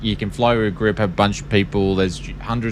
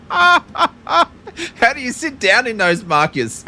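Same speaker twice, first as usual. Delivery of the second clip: laughing; slower than usual. Real, not acted. Laughing through teasing question.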